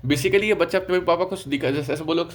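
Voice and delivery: ordinary talk, nothing marked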